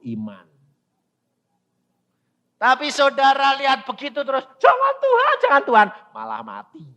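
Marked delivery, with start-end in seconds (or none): 4.6-5.9 high, loud begging; hammed-up panicky prayer; mock pleading. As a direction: wail it in a very high voice. Mimics people pleading endlessly with God.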